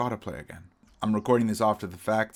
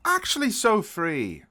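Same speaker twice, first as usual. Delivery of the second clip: high, loud exasperated yelling at himself; real frustrated outburst at a chess blunder; 8 dB louder than usual. Real, not acted. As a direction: rant at your own blunder, near shrieking. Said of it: "I'm really frustrated".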